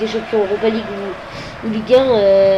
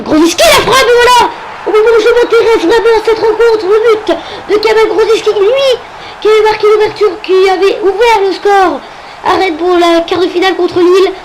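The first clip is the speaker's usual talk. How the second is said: high, loud goal commentary; excited goal call; 9 semitones higher, 6 dB louder than usual. Acted. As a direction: shout the goal as it goes in. Calling a goal in match.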